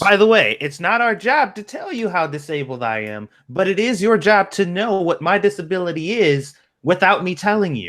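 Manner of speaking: high, preachy mock lecture; mock lecturing. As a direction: parody a self-righteous lecturer up high. Mockingly voices article author.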